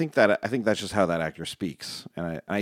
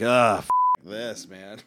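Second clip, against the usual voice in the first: slow, exasperated grumble; mock weary warrior giving up; fed-up groan; slower than usual. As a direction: sigh it out, done with it all. Quoting Baze flopping down.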